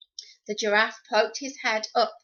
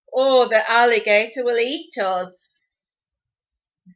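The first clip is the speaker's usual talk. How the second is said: slow, louder hissing snake voice; 8 dB louder, slower than usual. Acted. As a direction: stretch the words out like a hissing snake. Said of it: "hissed the snake".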